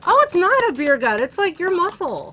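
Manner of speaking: high, loud playful defense; playful high teasing. Pretend. Playful defense of host's body.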